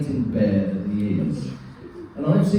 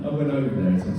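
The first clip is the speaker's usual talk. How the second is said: usual speaking voice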